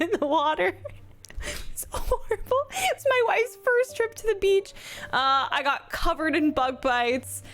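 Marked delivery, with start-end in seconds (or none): 1.7-4.7 high, hammed-up woe; mock dramatic despair. Streamer hamming up her vacation woes to the host. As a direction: overplay the ruined holiday for laughs. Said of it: "I'm just memeing".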